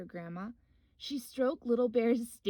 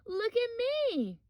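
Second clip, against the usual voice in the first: high, louder, slow call; 12 semitones higher, 5 dB louder, slower than usual. Acted. Little Bear showing off to Grandma, high call. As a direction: call out proudly to be watched. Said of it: "he called".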